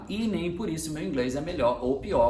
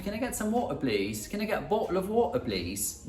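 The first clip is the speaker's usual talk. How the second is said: put-on higher British accent, "wa'er"; British passenger; 5 semitones higher than usual. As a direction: ask for water as a British passenger. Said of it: "no voo eu escutava muito".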